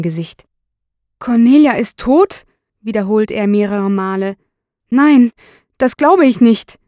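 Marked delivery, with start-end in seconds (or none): 1.2-2.5 louder, higher, incredulous question; acted shock at a death; stunned disbelief. A narrator's reading. Suspect repeats the shocking news. 4.9-6.8 loud, high-pitched refusal to believe; shocked denial. A narrator's reading. Suspect refuses to believe death.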